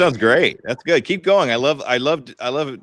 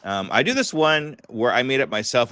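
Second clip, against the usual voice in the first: ordinary talk, nothing marked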